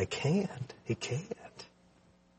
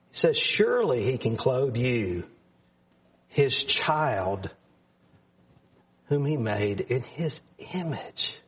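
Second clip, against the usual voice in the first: ordinary delivery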